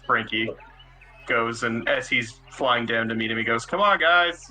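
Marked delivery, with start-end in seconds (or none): 3.7-4.4 louder, higher call to the group; calling shout. Acted. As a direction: shout it back into the temple. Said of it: "Shouts it into the temple".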